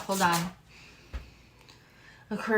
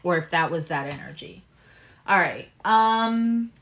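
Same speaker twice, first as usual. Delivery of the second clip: ordinary talk, nothing marked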